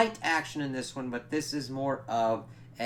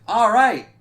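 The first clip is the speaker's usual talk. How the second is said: loud retold exclamation; 10 semitones higher, 15 dB louder than usual. Retold reaction to lightning strike.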